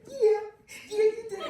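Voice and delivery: high, loud mock cheer; proud uncle. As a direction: imitate an uncle cheering with pride. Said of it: "He's like a proud uncle".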